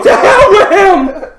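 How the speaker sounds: laughing, high, loud, slow shout; laughing while redoing Will's big yell; laughing mock yell. Repeats the outburst, laughing.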